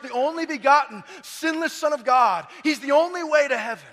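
loud, driving sermon voice; full-force preaching. Acted. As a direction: hammer each point home to the congregation.

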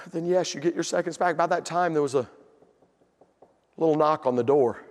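usual speaking voice

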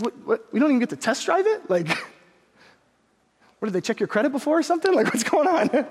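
high, sputtering protest; incredulous kid. Retold or imitated. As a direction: act out a boy who can't believe it. Disappointed boy questions his father.

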